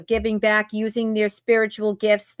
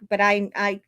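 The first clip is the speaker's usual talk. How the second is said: ordinary talk, nothing marked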